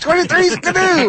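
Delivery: high, put-on prospector voice; old prospector. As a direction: gabble like an old miner. Mocking prospector gibberish.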